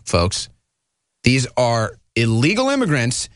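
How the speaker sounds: usual speaking voice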